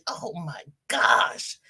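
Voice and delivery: ordinary delivery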